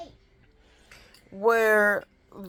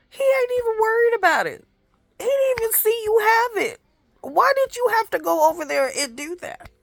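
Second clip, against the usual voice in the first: much higher and louder, fast, fed-up disbelief; exasperated; 14 semitones higher, 4 dB louder, faster than usual. Real, not acted. Exasperated at toddler's grabbing.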